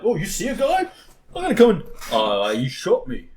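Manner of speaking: high, loud mock whining; childish player. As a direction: imitate a gamer being a big baby. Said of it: "they're fucking children about it".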